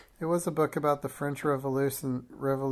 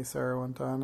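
ordinary delivery

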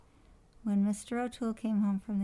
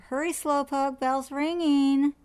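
high, loud parrot yell; yelling after her; 5 semitones higher, 7 dB louder, slower than usual. A narrator's reading. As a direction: call it out, stretching the last words. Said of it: "Penelope yelled after her".

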